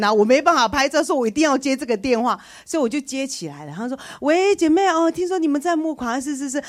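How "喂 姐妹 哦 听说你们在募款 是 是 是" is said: phone caller. Retold or imitated.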